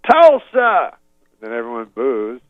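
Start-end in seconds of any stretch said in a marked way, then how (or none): 0.1-1.0 high loud rival-team call; Cosby baiting the crowd, loud; crowd shout. Retold or imitated. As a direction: shout the name out to the stands. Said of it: "Then he goes".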